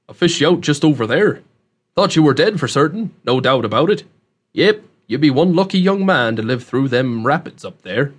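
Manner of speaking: folksy country dialect with punched words; rustic rescuer, matter-of-fact. Acted. Host tells young man he fished him out, rustic voice. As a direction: talk like a plain-spoken backwoods local.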